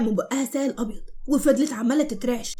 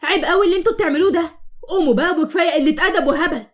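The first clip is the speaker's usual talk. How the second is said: higher, louder furious scolding; angry shouting; 5 semitones higher, 4 dB louder than usual. Acted. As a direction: tell your sisters off for their stupid prank. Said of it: "بكل غضب وزعقت".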